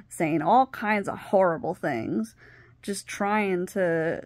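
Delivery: ordinary talk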